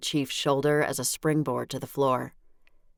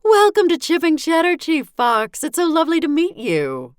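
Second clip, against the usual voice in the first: high, gushing mother voice, much higher and louder; a syrupy, higher mother gushing over a guest; flirty mother; 9 dB louder than usual.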